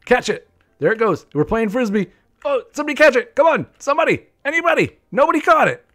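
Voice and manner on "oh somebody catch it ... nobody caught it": loud, high-pitched urgent calls; excited shouting. Real, not acted. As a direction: yell for someone to catch it. Frantic calls during frisbee game.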